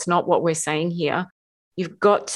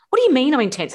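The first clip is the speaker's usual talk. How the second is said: higher, louder indignant "what do you mean"; offended outburst; 8 semitones higher, 4 dB louder, faster than usual. Retold or imitated. Defensive wife firing back at her husband, indignant. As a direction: bristle back, wounded pride. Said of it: "And I'm like".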